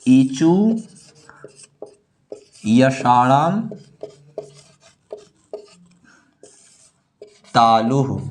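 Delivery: one word at a time, with very long pauses; word-by-word dictation. Shown as a demonstration. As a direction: dictate it piece by piece as you write. Dictating the sutra while writing it. (लिखवाते हुए, एक-एक शब्द रुक-रुककर)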